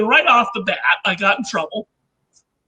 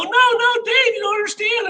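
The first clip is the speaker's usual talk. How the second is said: much higher, urgent mock pleading; pleading protest; 11 semitones higher than usual. Inventor tries to calm mad Dave, high pleading protest. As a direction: imitate yourself begging to be understood.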